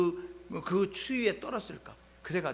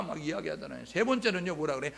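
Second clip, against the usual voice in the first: ordinary delivery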